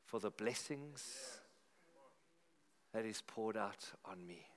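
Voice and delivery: searching for the words as if overwhelmed with gratitude; dramatic heartfelt reading. Acted. Reading the psalm with feeling.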